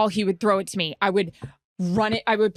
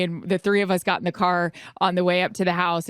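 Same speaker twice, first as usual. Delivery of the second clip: ordinary delivery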